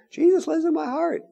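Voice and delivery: high, small sing-out of faith; mimicked believer. Preacher mimicking someone claiming Jesus in the heart, high voice. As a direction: put on a high little voice.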